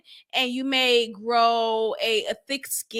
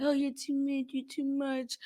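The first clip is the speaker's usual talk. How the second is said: soft sad echo; 10 dB quieter than usual. Retold or imitated. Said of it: "just like".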